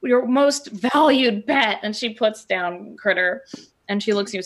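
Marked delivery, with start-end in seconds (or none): 0.0-1.8 high, slow over-sweet apology; apologetic gush. Acted. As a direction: fawn apologetically, drawn out. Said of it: "she's just like".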